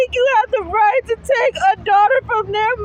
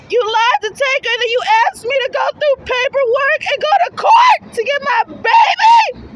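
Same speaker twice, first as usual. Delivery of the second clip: building to a shout; 5 semitones higher, 4 dB louder than usual. Real, not acted. Mother demanding her baby back, building to a tearful shout. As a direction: let it build to a cry.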